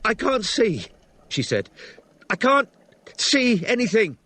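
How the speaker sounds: high, strained woman's voice; distressed. A narrator's reading. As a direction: report it in rising distress. Injured woman on the phone.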